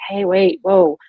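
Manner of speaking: high, loud call to halt; urgent interruption. Pretend. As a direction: cut in suddenly, pitch jumping on whoa. Models interrupting her spouse.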